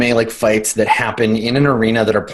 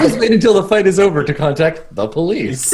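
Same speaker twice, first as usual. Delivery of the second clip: higher-pitched recorded phone message; automated message voice; 5 semitones higher than usual. Acted. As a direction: read it like an automated hotline. Automated emergency services message.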